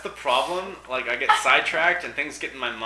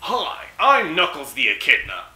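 loud, slow, cartoonish hero voice; Knuckles impression; 3 semitones higher, 7 dB louder than usual. Gamer does Knuckles's intro in his voice. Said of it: "voiced by Dan Green".

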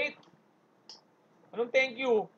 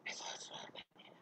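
hushed, whispered announcer voice; Resorts World announcer; 20 dB quieter than usual. Retold or imitated. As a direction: mimic a venue announcement in a breathy whisper. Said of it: "ikaw ba nagsasalita sa Resorts World?". (pabulong na boses ng tagapag-anunsyo)

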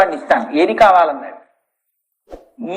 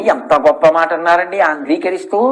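ordinary talk

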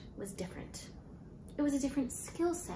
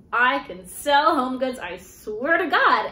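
loud, slow, insistent plea; desperate insistence; 3 semitones higher, 11 dB louder, slower than usual. Retold or imitated. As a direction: beg them, every word pushed.

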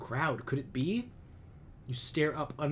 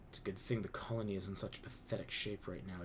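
softer bitter muttering; muttered under breath; 3 semitones lower, 6 dB quieter than usual. A narrator's reading. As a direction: say it only for those next to you. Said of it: "your mom mutters under her breath".

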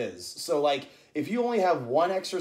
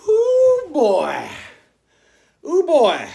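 loud, high whoop falling away; post-exertion whoop; 9 semitones higher, 10 dB louder, much slower than usual. Real, not acted. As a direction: let out the effort of the set. Exclaims after finishing ten squats.

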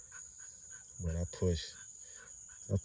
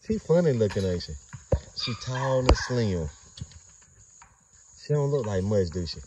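playful teasing; 4 semitones higher, 5 dB louder than usual. Real, not acted. Teasing about a dog.

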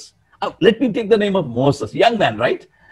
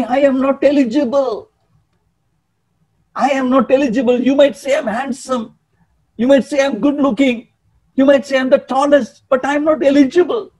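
acted self-deprecation, higher in pitch; humble protest; 6 semitones higher, faster than usual. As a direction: insist on being unworthy, voice held high. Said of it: "Humble, so much he was".

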